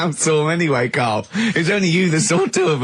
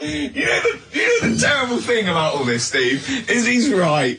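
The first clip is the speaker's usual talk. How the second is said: talking through giggles; barely holding it together, laughing; 5 semitones higher than usual. Real, not acted. Ricky to Steve about Carl, laughing. Cracking up at Carl's logic.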